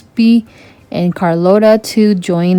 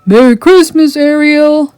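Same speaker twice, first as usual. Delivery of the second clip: louder, higher greeting; calling; 8 semitones higher, 5 dB louder than usual. A narrator's reading. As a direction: call across the water. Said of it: "they called".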